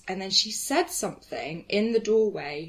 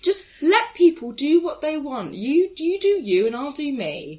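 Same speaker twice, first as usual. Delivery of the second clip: exasperated plea; 7 semitones higher, 8 dB louder than usual. Retold or imitated. Plea continues.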